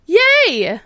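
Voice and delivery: high, loud "Yay!"; mock cheer. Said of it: "And I was like".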